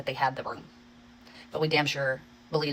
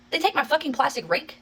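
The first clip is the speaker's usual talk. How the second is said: high, incredulous question; retold disbelief, pitched way up; baffled disbelief; 10 semitones higher than usual. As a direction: squeak it out in disbelief. Husband baffled at stolen rake.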